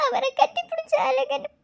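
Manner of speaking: tearful plea broken by sobs; sobbing. Acted. Begging Akalya to let her see Kavin, sobbing. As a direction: cry and beg for help. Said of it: "நீ அழுகிறத".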